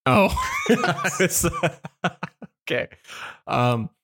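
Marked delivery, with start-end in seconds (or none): 0.4-1.2 sudden high laugh; burst of laughter. Real, not acted.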